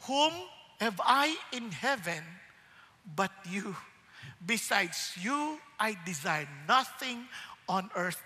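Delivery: prayerful. Pretend. Quoting Psalm as personal prayer.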